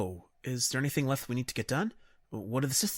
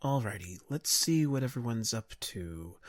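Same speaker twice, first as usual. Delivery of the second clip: ordinary talk, nothing marked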